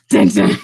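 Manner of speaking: low, loud mock suspense sting; mock-ominous soundtrack hit; dramatic sting. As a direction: punch out two deep ominous notes. Imitated dramatic sting for stare.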